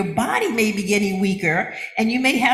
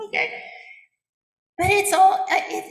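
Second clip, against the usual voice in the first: ordinary delivery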